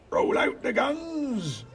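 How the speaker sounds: high, long-drawn ship's command; slow, stretched call, pitch way up; captain calling out. A narrator's reading. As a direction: bellow the order across the deck. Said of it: "called the captain".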